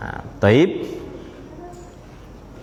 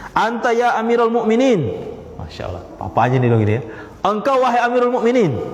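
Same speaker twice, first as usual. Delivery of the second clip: higher pitched reproach to the caliph; reproachful son; 6 semitones higher than usual. Retold or imitated. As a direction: chide the father respectfully.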